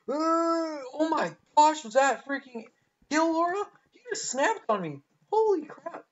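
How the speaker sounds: high, loud startled cries; shocked outburst. Real, not acted. Shocked as an enemy snaps onto him, yelping. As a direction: yelp in disbelief at the kill. Sudden snap kill in game.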